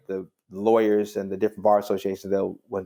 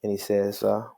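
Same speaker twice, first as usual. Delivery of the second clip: normal talking voice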